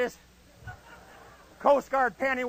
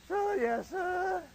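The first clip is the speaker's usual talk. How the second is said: high, softer recruit voice; Freeman Spears; 7 semitones higher, 5 dB quieter, much slower than usual. Retold or imitated. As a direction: answer the sergeant in a small high voice. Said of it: "He talked just like that".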